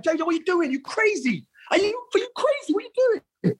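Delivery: retold alarmed protest. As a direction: act out shocked teammates stopping a madman. Retold alarmed teammates rushing in.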